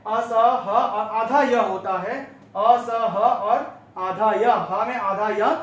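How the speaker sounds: असह और आधा य drawn out very slowly, a little laugh; slow spelling out. Shown as a demonstration. Teacher spelling the word out syllable by syllable, slowly. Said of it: "ये अशुद्ध है".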